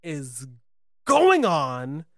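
halting disbelief building to a loud outburst; acted-out bewilderment, swelling at the end; retold disbelief. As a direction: break it up with stunned pauses, then get loud. Retold shocked reaction, drawn out.

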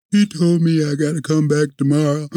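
near tears, complaining to grandma he has to go back; upset young self. Retold or imitated. Whining to grandma about going back tomorrow.

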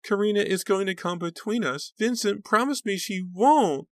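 very high mock-lovesick pleading; high, swooning mimicry. A narrator's reading. Sarah teasing her sister about Vincent, high swooning mimicry. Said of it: "mimicked in a high, swooning voice".

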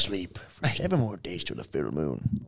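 low gruff pirate voice. Acted. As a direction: growl it like a bad pirate. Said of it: "Why am I sound like a pirate".

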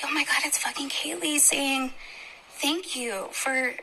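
awed, slow whisper; hushed disbelief, drawn out. Real, not acted. Continues awed realization.

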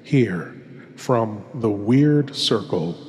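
put-on velvety old-time horror host; horror host intro. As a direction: lure the listener into the dark like a creepy host. Host introduces the radio tale.

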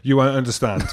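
ordinary talk

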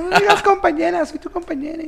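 mock crying plea. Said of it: "que estaba llorando ahí".

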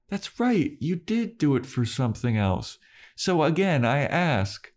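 passive-aggressive. Retold or imitated. Giles corners Spike over the money, passive-aggressive. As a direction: lead them into the trap, voice lifted. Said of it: "passive aggressive".

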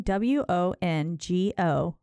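letter by letter, slow and deliberate; spelled out slowly. Shown as a demonstration. Host spelling the website name for listeners, slow and clear. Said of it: "Go to Wongo".

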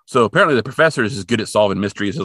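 ordinary talk

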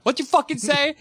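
high, hammed-up challenge; mock tough princess. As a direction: throw it at Bowser like a brawler. Repeat of Peach threat.

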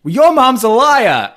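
high, loud mock accusation; accusing retort. Imitating Kathy arguing with Teresa, loud accusing retort. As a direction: imitate a taunt thrown in a family fight.

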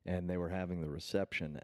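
usual speaking voice

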